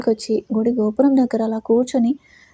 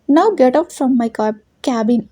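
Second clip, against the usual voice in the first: usual speaking voice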